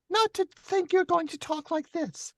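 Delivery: falsetto far above own pitch; hammed-up squeaky falsetto woman, deliberately unreal; exaggerated female voice. Shown as a demonstration. Narrator showing how not to voice women, high falsetto. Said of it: "nobody talks like that".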